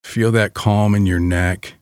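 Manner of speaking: usual speaking voice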